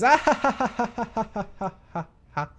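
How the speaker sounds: high staccato ha-ha fading away; fake read-out laugh. Vlogger reading her typed laughter, mechanical mock laugh.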